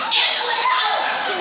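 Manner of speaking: very high, loud hammed-up scream; guardian angel's cry. Guardian angel lassoing falling mom, mock high scream. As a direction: shriek the name in mock alarm. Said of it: "her guardian angel was watching".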